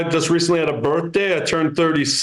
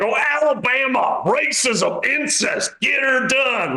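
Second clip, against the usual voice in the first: mock Southern yell; 10 semitones higher, slower than usual. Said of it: "I'm not gonna be like".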